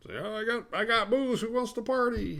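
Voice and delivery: very high-pitched mock party whoop; exaggerated, silly high character voice; hammed-up party host. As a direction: squeal it like an over-eager party guy. Exaggerated quote of Mick offering drinks.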